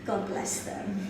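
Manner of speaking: usual speaking voice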